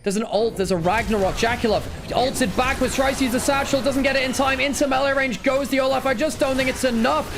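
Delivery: high, urgent esports commentary; live play-by-play. Real, not acted. Caster calling a team fight as it unfolds, excited.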